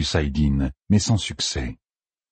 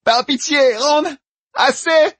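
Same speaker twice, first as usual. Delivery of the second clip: very high, loud cries for mercy; screaming plea; 4 dB louder, slower than usual. A narrator's reading. Tortured young woman begs Rand to stop, screaming. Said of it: "la jeune femme cria".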